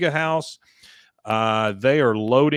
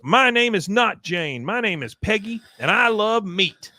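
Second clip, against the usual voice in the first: defiant Peggy; 8 semitones higher than usual. Retold or imitated.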